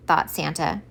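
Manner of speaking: plain reading voice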